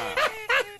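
cracks up mid-joke; burst of laughter. Real, not acted. Host cracks up at own joke.

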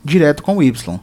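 ordinary talk, nothing marked

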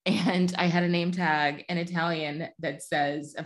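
normal talking voice

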